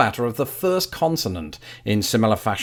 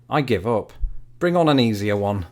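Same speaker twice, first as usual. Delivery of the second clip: ordinary talk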